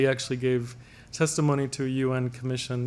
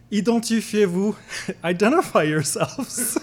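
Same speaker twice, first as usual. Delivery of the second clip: mock yell; 6 semitones higher, 3 dB louder than usual. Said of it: "run out yelling".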